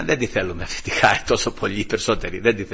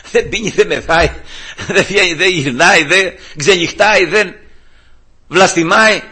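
loud, raised-pitch mock bafflement; incredulous recital; 6 semitones higher, 5 dB louder than usual. Judge repeats the wife's complaints, incredulous recital. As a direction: count off each item in disbelief.

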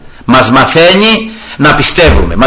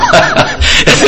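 high laugh. Real, not acted. Preacher laughs at own joke.

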